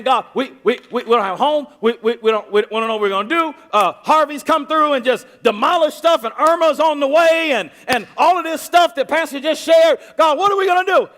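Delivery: fast, high, loud panicked stammer; whining complaint. Retold or imitated. People complaining to God about the storms. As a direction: gush it like a flustered complainer. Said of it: "They're saying".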